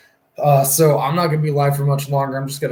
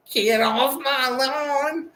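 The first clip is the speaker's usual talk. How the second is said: put-on high, very slow, strained old-timer voice; grumpy old person; 13 semitones higher, much slower than usual. Streamer mocking noisy kids as a grumpy old man. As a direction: creak it out like a cranky old man yelling at kids. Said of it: "now I'm sounding like an old person".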